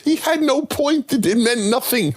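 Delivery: chipmunk-high edited echo; the same line shot way up in pitch. Acted. YTP edit repeats it pitched.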